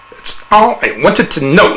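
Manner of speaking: a hint of smug doctor; satisfied psychiatrist. A narrator's reading. Psychiatrist concludes.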